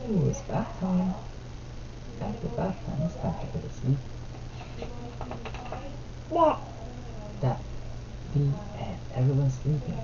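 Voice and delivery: soft, hushed bedtime-story voice; quiet picture-book reading to a little one. Real, not acted. Reads picture book to toddler.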